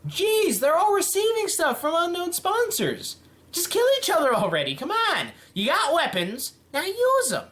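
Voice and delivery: high-pitched, impatient outburst; exasperated urging. Pretend. Commentator urging villains to fight, exasperated. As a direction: push them to act, pitch jumping way up.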